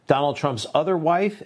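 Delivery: usual speaking voice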